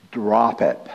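low, deliberate put-down as his wife; imitating wife's stern order; wife's firm warning. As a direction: shut the argument down in a low voice. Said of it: "she'll look at me and she says".

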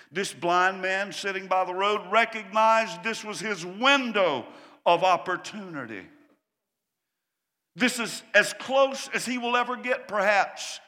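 loud, driving preacher's voice; full-force preaching. Acted. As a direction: preach it out to the back row.

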